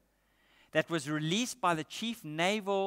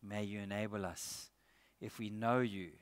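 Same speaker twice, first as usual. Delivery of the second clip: soft, lower prayer voice; 4 semitones lower, 5 dB quieter than usual. Real, not acted. Preacher praying over the congregation, soft and reverent. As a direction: pray quietly and reverently.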